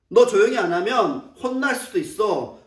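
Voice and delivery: ordinary talk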